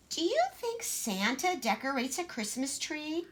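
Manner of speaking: put-on small, timid little girl voice; Gracie, shy child. Shy little Gracie asking her sister, timid.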